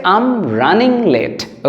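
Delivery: drawn out very slowly, word by word; slow teaching read. Shown as a demonstration. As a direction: spell it out for learners. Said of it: "সেটা হচ্ছে".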